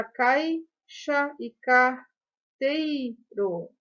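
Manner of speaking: "caixa e carteiro" very slowly, cai-xa, car-tei-ro; slow, separated syllables in "caixa e carteiro"; split into syllables. Shown as a demonstration. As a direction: sound out "caixa e carteiro", diphthongs held together. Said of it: "o A e o I da palavra caixa juntinhos".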